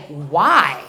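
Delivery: deep, comically stunned question; incredulous why. Retold or imitated. Patient hears dentists are unhappy, incredulous. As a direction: drop low on one drawn-out word. Stunned by hygienist's grim fact.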